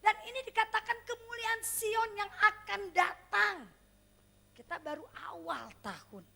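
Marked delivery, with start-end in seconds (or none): 0.0-3.7 loud proclamation. Real, not acted. Preacher proclaiming the promise to the congregation, booming. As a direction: proclaim it to the whole church.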